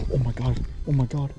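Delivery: quiet, rising breathless anticipation; hushed excitement. Real, not acted. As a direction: hold your breath as it builds. Watching fish approach bait, hushed.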